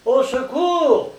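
very high, loud, drawn-out yell; howled cry for help. Acted. Strong shoved into the lard vat, howling for help. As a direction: scream for rescue, stretching it out. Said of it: "hurla Strong".

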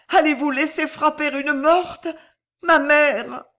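high, loud desperate plea; voix étranglée. A narrator's reading. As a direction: beg in a strangled cry. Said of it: "d'une voix étranglée". (supplication étranglée, aiguë et forte)

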